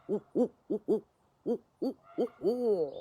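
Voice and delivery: high hooting owl call, each ooh rising and falling; barred owl call. Shown as a demonstration. Handler performs a barred owl call for the audience, hooting. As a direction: hoot like a barred owl at dusk. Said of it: "can we hear what barred owls sound like".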